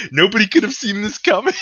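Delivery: high, loud, hammed-up Trump protest; mock Trump. Podcaster mocking Trump after the meteor hits, high mock shout. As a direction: play Trump crying innocence, over the top. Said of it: "Trump will be like".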